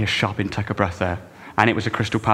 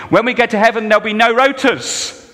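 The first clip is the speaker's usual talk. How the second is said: much higher, much louder line for an amen; rousing preacher call; 12 semitones higher, 8 dB louder than usual. Shown as a demonstration. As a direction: fire it out to get an amen from the church. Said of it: "people say amen at that kind of thing".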